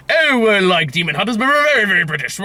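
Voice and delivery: put-on jokey posh British accent; playful imitation of a British accent; mock British accent. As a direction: do the demon hunters in a hammed-up British accent. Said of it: "that's how I imagine they spoke".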